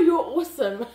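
normal talking voice

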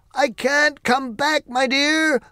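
high, slow, loud call from above. Acted. Wizard calling down to Dorothy, loud and slow. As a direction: call down gently but far. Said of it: "called Oz from the basket".

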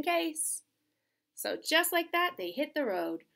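lively storybook voice with character turns; reading to children. Acted. Reading Otis cheering Thelma on, storybook voice. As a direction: bring Otis and Thelma to life.

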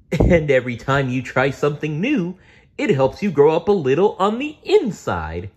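encouraging Barney. Acted. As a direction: cheer up a child. Barney explains growing up.

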